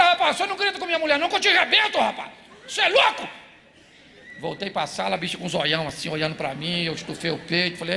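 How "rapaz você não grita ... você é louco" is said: mock angry threat. Father's mock threat to son.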